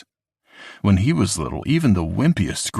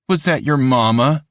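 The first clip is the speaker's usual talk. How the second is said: put-on taunting kid; 7 semitones higher, 5 dB louder than usual. A narrator's reading.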